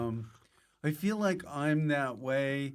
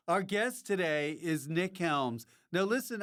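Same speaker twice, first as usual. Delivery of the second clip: ordinary talk, nothing marked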